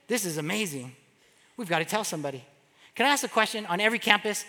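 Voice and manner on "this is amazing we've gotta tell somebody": soft, low voice of wonder; hushed amazement. Retold or imitated. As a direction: lower and soften it, imitating awed realization. Lepers' awed decision to share.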